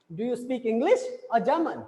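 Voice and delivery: "English" rises, "German" falls; rising then falling. Shown as a demonstration. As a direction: slowly lift "English", then drop on "German". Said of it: "we are having a falling intonations". ("English" पर चढ़ता, "German" पर गिरता स्वर)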